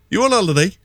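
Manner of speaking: high-pitched imitation posh English; posh accent. Fella in a Pennines pub asking a visitor, imitated posh accent. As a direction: imitate a quite posh stranger. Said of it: "Like, actually quite posh".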